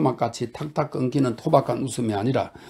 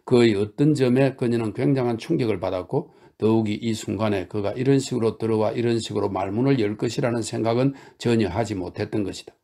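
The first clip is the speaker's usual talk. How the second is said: plain reading voice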